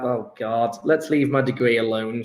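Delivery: usual speaking voice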